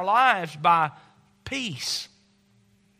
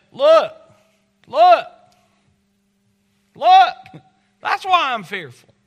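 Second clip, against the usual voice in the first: loud, high "Look!" punched out over and over; loud insistent retort; 8 semitones higher, 12 dB louder, slower than usual. Acted. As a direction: point at the danger, loud and urgent. Acting out answer to Jesus.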